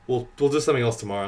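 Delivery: ordinary talk, nothing marked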